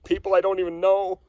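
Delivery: gushing with gratitude; emotional excitement. Real, not acted. Thanking helpers after the hunt.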